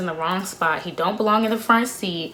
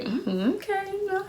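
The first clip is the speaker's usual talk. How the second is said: coy approval; 10 semitones higher, 8 dB quieter than usual. Retold or imitated. Reacting while being kissed.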